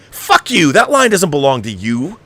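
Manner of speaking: usual speaking voice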